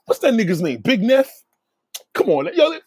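usual speaking voice

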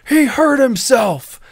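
high, loud, drawn-out shout; buddy yelling back. Retold or imitated. Buddy stands up for coworker to the manager, high yell. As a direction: holler it back in a friend's defense. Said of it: "he yelled back".

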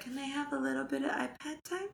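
lightly voiced sweet little girl; daughter asking. Daughter Sienna asking for iPad, soft little-girl voice. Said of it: "She's like".

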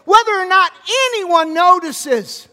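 loud, high preacher's cry, slowing on anyone notices; full-voiced pulpit delivery; fiery preaching. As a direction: preach it out full force to the room. Preaching at full force, unnamed.

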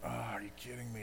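softly voiced exasperation, imitating Jesus; a muted "are you kidding me"; exasperated. Jesus fed up with the Pharisees, mock exasperated. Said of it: "like, exasperated".

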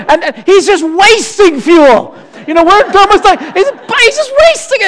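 high, indignant, slowed disbelief; mock outrage. Preacher retelling the jet fighter, mock outrage. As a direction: act out the indignation, pitching up.

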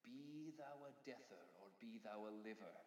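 soft recited farewell verse; poem recital. Acted. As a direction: recite it softly over music.

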